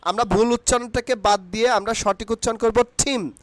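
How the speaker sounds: ordinary talk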